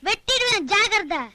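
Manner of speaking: high-pitched menacing bluster; threatening warning. Acted. Defiant threat to cut a rival, high bluster.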